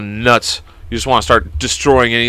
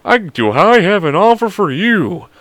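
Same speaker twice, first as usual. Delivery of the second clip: slow, higher mock sales pitch; pushy salesman; 7 semitones higher than usual. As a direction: imitate a hustler drawing out the offer. Imitating a salesman in a suit.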